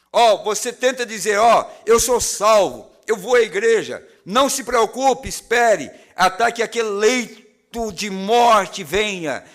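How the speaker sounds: fervent preacher, pressing and loud; preaching at full force. Acted. Preacher warning the lost congregation, fervent and pressing. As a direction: drive it home to the congregation.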